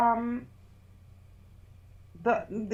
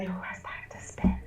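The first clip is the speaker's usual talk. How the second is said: whisper; 2 semitones lower, 7 dB quieter than usual. Real, not acted. Joking aside about spinning time.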